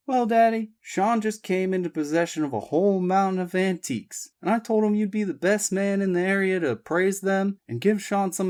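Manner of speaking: high, eager daughter, fast at the end; Abby voiced. A narrator's reading. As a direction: sell the idea to her father. Abby pitching idea.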